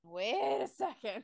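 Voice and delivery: drawn out at half speed; slow, drawn-out realization; slow-dawning double take. Retold or imitated. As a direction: stretch out each word as it sinks in. Repeats reaction, drawn out.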